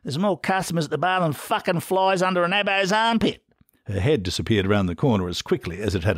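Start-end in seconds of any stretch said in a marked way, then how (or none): 0.0-3.4 much higher, coarse yelling barmaid; bellowing wife. A narrator's reading. The wife ordering Steve back to the bar, coarse shouting. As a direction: yell orders at him from the next room. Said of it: "she bellowed".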